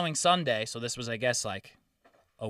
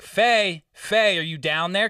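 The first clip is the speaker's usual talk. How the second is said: raised, higher call to a neighbour; 4 semitones higher, 5 dB louder, slower than usual. Retold or imitated. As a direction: call down the stairs to her. Calling down to the neighbour.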